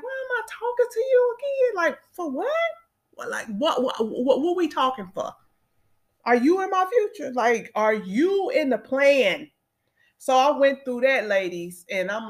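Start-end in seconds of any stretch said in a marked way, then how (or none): 0.0-9.5 high, exasperated questioning, half to herself; incredulous inner voice. Retold or imitated. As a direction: toss the question off high and fed up, like who are you again. Questioning unwanted contacts in her phone.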